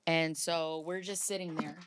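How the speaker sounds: normal talking voice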